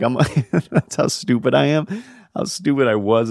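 usual speaking voice

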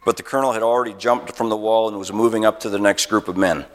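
ordinary talk

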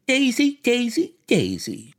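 high, taunting chant of a name; shrieked name, falling back on the last one; defiant shout. A narrator's reading. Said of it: "shouted Missus Wilson".

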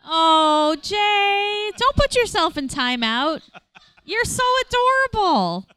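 high, cooing, tender voice. Real, not acted. Host cooing at guest.

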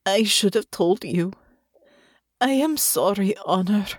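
understated tearful apology; remorseful, lightly choked-up voice; thick with tears. Acted. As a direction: apologise as if holding back tears. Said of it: "her voice thick with tears".

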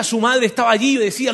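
ordinary talk

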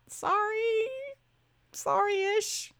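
lilting up high, slow, unrepentant; playful sing-song mock apology; teasing half-apology. Host half-apologizing to fans, teasing high lilt.

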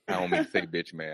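usual speaking voice

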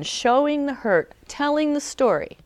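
normal talking voice

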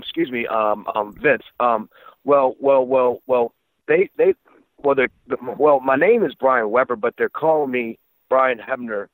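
re-enacted flustered stammer, tripping over the opening words; nervous stuttering. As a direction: stammer and restart as if nervously addressing the boss. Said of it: "I'm stuttering, I'm sweating like shit".

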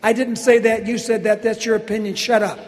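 loud mock-defensive protest; mock indignant. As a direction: cut in with comic indignation. Comic interruption of a fan's question.

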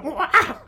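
high playful chimp hoot; mock chimp call. Driving past chimpanzees, hooting at them.

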